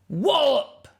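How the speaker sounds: high, punchy crash word; loud wallop. Acted. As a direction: slam it out like an impact. Birds crash into iceberg.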